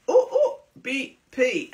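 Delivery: high laughing burst. Real, not acted. Reading out the promo code, breaking into laughter. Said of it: "laughing at own code".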